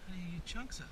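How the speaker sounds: quiet repeat. Real, not acted. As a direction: ask yourself again under your breath. Asks again quietly.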